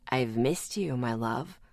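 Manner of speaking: low, soft, creepy father voice; a low sinister lover's murmur; demon in father's voice. Acted. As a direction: croon it like a demon wearing a dead man's voice. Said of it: "using my father's voice".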